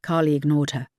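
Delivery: usual speaking voice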